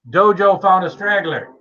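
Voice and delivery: ordinary talk, nothing marked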